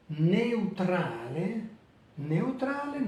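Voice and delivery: neutrale slowly, eu as one syllable; slow careful Italian neutrale; Italian diphthong. Shown as a demonstration. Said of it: "les deux voyelles, à l'intérieur de la même syllabe".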